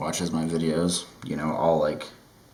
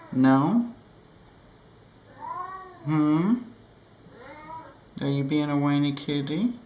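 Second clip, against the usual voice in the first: pet talk; 8 semitones higher than usual. Real, not acted. Talking to her whining cat, high cooing baby voice.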